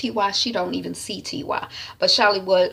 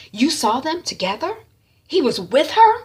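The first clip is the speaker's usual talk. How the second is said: higher, rising disbelief; incredulous questioning; 8 semitones higher, 4 dB louder than usual. Retold or imitated. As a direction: question it as if caught off guard. Sister reacts to hearing of wife.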